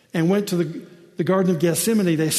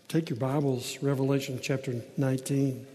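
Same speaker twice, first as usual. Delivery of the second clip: usual speaking voice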